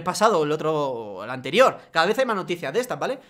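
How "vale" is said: ordinary talk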